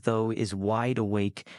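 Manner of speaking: ordinary delivery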